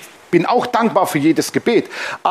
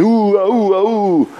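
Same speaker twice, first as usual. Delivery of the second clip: mock chant; slower than usual. Mimicking angel worship.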